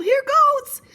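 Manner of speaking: very high, loud yell; excited yell. Acted. Piggy holding his nose before jumping in, high yell. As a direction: yell it as if leaping in. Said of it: "He held his nose and yelled".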